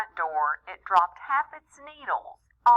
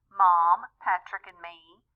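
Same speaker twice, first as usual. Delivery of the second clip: usual speaking voice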